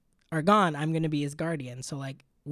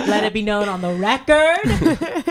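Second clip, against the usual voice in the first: laughing mock statement; 4 semitones higher, 7 dB louder, slower than usual. Joking alibi, laughing.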